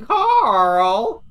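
loud, high, drawn-out call of a name; stretched-out mock holler; imitated yell. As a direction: mimic someone yelling across the house. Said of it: "All I hear is Jacko yelling".

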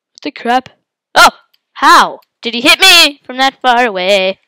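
normal talking voice

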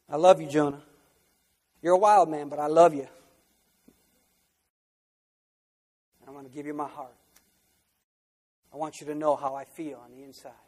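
gently, like a loving father; tender God. Acted. God telling Jonah tenderly he loves him.

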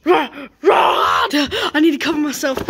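slow, loud, high panicked wail; frightened shout; panicked cry. Acted. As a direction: cry out in terror, slowly. Panicking at the metal pipe.

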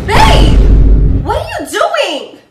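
loud startled yell; shocked shout. Acted. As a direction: yell it out in alarm. Shocked at partner's touch.

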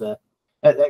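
ordinary talk

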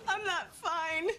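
slow wail, pitched high; crying. Acted. Jeannie admits to a friend she is not fine, crying. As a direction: break down sobbing on drawn-out words. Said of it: "was that just crying".

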